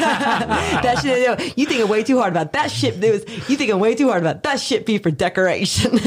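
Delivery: high-pitched, amused repeat of her line; laughing imitation. Laughing while repeating woman's line.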